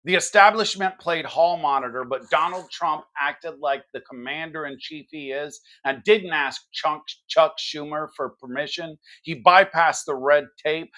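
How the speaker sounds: ordinary talk, nothing marked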